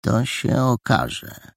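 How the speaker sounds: slow, grave and serious. A narrator's reading. Paragon answers his friend's theory, very seriously. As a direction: weigh each word gravely. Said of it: "rzekł niezwykle poważnie Paragon".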